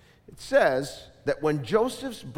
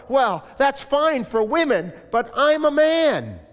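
higher and louder, a man brushing it off; louder mock-macho retort; proud objection; 8 semitones higher, 7 dB louder than usual. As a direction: scoff like a proud man who won't bend. Voicing Junior's proud objection.

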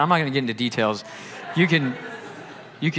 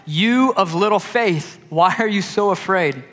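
Jesus rebuking; 6 semitones higher, 4 dB louder, slower than usual. Acted. Preacher voicing Jesus rebuke.

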